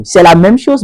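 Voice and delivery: ordinary talk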